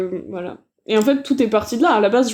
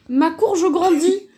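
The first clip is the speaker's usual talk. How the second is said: mock mom voice; 7 semitones higher, slower than usual. Reading mom's email subject aloud.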